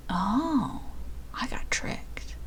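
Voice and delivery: hushed, deflated whisper; dismayed whisper. Real, not acted. Streamer realizing she missed a quiz answer, dismayed whisper. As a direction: whisper it as if caught out.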